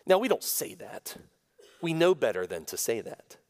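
ordinary talk

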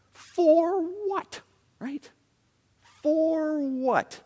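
high, drawn-out "for what?"; confrontational disbelief. Acted. Preacher voicing a resentful believer's retort, confrontational disbelief. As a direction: stretch it out as an indignant challenge. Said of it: "You just hear it".

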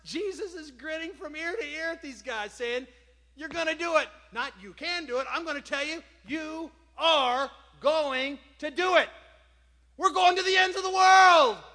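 high, rousing, loud declaration; exultant pulpit charge, pitched high; full-force preaching. Acted.

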